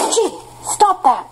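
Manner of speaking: high, slow telling-off of a pet; sharp, high call to stop the dog; scolding the dog. Real, not acted.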